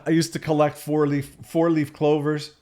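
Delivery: ordinary delivery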